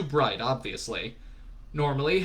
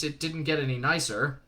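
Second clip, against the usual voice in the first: usual speaking voice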